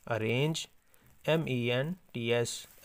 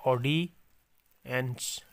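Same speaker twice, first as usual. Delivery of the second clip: slow dictation word; much slower than usual. Shown as a demonstration. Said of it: "slow dictation of word".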